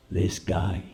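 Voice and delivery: low, slow, quiet menace; menacing low voice. Retold or imitated. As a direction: lean in and threaten softly. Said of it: "so quiet".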